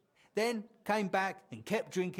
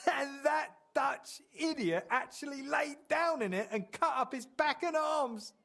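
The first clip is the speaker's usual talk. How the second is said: laughing while telling; 9 semitones higher than usual. Real, not acted.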